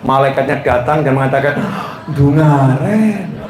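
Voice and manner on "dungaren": slow, drawn-out mock surprise; lightly acted amazement; mildly surprised angel. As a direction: stretch each word in wondering disbelief. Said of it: "kaget semuanya".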